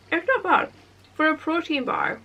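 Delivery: talking while chewing a bite; words round a mouthful of food; mouth full. Real, not acted. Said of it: "Sorry, I'm talking with my mouth full".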